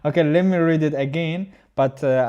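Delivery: ordinary delivery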